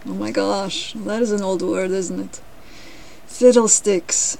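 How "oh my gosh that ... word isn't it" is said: usual speaking voice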